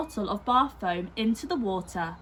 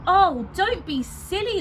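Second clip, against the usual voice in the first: higher, louder impatient dismissal; exasperated; 9 semitones higher, 6 dB louder than usual. A narrator's reading. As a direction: brush off a sulking child. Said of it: "said Missus Large".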